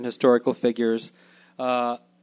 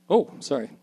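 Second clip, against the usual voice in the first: ordinary delivery, nothing marked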